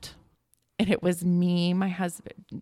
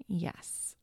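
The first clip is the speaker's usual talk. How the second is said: breathy hushed yes, softer; calm whispered yes; 8 dB quieter than usual. Retold or imitated. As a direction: let the yes fall to a whisper. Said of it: "And she calmly just said".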